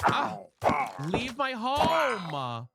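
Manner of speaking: loud, dragged-out shout at the mob; frustrated yell. Real, not acted. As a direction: yell it long and loud to chase it out. Yelling at mob in house.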